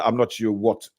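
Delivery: usual speaking voice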